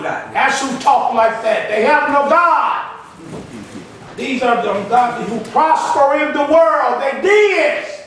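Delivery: loud, drawn-out preacher's delivery; full-force preaching. Real, not acted. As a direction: preach it hard, building to a high, loud finish.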